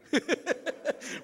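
a quick airy laugh breaking out; breathy real laugh. Real, not acted. Preacher laughing at his childhood boldness, a quick airy laugh. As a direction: laugh at the memory, half under your breath.

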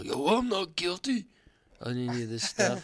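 hammed-up rapper swagger. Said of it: "he's like".

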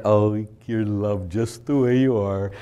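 slow, syrupy reassurance; mock soothing priest. Priest indulging people at Mass, slow mock sweetness. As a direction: coo it slowly, tongue in cheek.

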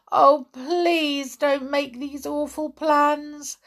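high, slow, drawn-out plea; begging girl. A narrator's reading. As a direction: implore them anxiously. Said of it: "begged poor Anne".

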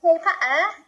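British-style dropped R in Potter; mock British pronunciation; British accent. Shown as a demonstration. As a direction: say it like a British person, exaggerated. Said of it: "British people would say something like".

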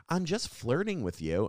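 retold heckler, playfully high; mock flirty heckler. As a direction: pitch it up to mimic her excuse.

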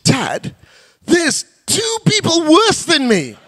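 put-on high, slow kid voice with a laugh; son's cheeky excuse to his dad, laughing. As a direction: play the cheeky boy proud of beating two kids. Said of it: "He goes".

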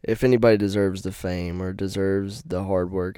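ordinary delivery

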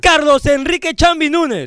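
read with gusto. Shown as a demonstration. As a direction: announce the donor's name with gusto. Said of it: "No, léelo con ganas".

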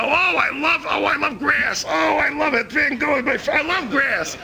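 high, hammed-up squealing rapture; clueless museum lump. Lump at the museum before a Van Gogh, gushing absurdly. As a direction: swoon over it, stretching the words out. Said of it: "we're, we're lumps".

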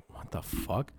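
breathy hushed what the fuck, almost no voice; whispered disbelief. Retold or imitated. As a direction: mutter it under your breath in disbelief. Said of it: "I was like".

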